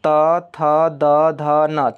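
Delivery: each dental letter sounded out separately, "त, थ, द, ध, न"; letter pronunciation demo. Shown as a demonstration. Teacher showing students which letters are dental, sounded out distinctly. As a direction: sound out "त, थ, द, ध, न" one by one. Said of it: "ल का एक बार उच्चारण करिएगा".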